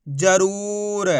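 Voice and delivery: जरूर read very slowly, रू held long; drawn-out long ू. Shown as a demonstration. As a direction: stretch the "oo" in जरूर. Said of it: "खींच के पढ़ेंगे".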